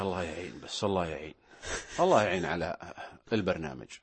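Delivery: low, heavy, defeated sigh of a voice; gloomy tone. Shown as a demonstration. Host demonstrating a gloomy tone to the presenter, low and weary. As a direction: sound like the programme is a burden. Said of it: "مثال".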